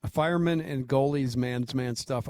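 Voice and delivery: usual speaking voice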